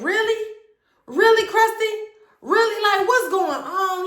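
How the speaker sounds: very high, incredulous shrieking; outraged disbelief. Retold or imitated. Woman confronting her boyfriend at his door, shrill disbelief. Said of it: "I said".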